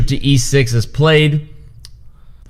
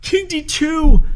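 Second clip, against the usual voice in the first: stunned excitement. Real, not acted. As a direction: drag it out in disbelief. Stunned repeat of the move.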